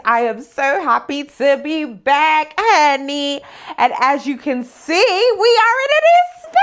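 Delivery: loud and high, bursting with joy; almost squeal it with delight; elated. Real, not acted. Vlogger showing viewers her new space, elated and loud.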